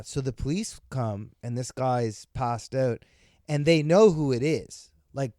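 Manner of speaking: ordinary talk, nothing marked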